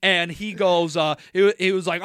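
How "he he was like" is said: ordinary talk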